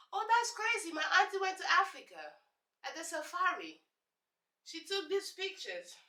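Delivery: high, airy voice of an ignorant classmate; mocking clueless classmate. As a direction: play the dumb curious classmate gushing. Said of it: "they hear the accent".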